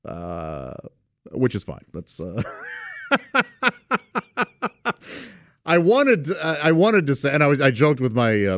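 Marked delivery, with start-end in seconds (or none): 2.5-5.2 a sudden high whooping laugh; high shrieking laugh. Real, not acted. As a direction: let the laugh burst out high. Laughs at the memory.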